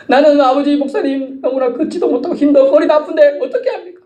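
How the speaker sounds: high-pitched protesting excuse; mock complaining. Congregant protesting aches to the pastor, mock complaint.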